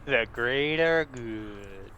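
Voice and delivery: slow, low West Country drawl; West Country accent. Pretend. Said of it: "repeats it back to them in a se-, West Country accent".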